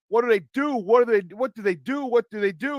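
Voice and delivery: high-pitched, put-on Chicago accent. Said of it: "Or my Chicago answer".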